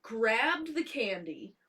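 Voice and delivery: slow, spaced-out words; deliberate scolding. Retold or imitated. Parent confronting child over candy, slow emphatic scolding. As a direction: lay out each step slowly, making the point.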